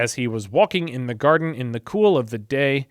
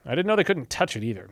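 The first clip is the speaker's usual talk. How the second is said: usual speaking voice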